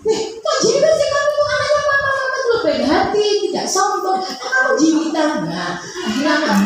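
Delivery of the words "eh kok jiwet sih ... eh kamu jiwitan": high sing-song scolding lecture; scolding mom. Acted. Mother scolding her child for pinching a friend, high sing-song reproach. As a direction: nag the child in a shrill-pitched reproach.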